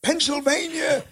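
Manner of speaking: high-pitched yelled imitation of an engineer; imitated engineer's yell from across the room; retold yell. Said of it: "the engineer who would yell".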